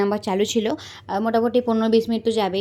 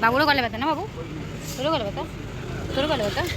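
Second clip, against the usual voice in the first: playful questioning; 5 semitones higher than usual. Real, not acted. Teasingly asking the child about sore throat.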